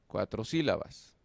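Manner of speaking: normal talking voice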